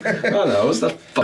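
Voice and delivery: ordinary talk, nothing marked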